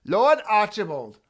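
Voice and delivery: loud, slow call across the house; calling the cat. Retold or imitated. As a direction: call the cat grandly by his title. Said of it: "I oftentimes refer to him as Lord Archibald".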